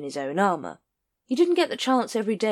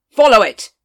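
curt order; 5 semitones higher, 15 dB louder than usual. Acted.

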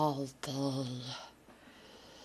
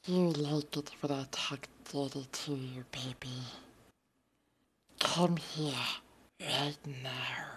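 whisper; 4 semitones lower, 8 dB quieter, faster than usual. Acted. Whispered dirty talk.

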